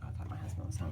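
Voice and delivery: whispered Oh, I thought my husband was home. Real, not acted. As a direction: hush it, startled. Startled, thinks husband walked in.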